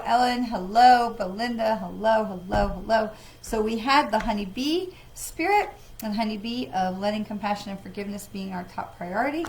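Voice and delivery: ordinary delivery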